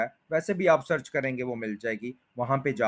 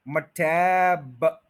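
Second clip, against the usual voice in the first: मटैब drawn out, leaning on टैब; slow मटैब, टैब held; stretched stress; 4 dB louder, much slower than usual. Shown as a demonstration. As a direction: hold the टैब long. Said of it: "टैब के ऊपर पूरा आप stay करें".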